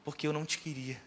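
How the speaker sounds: mild tearful plea, softened; retold tearful plea. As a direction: softly imitate a mother begging forgiveness. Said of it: "ela falou comigo chorando".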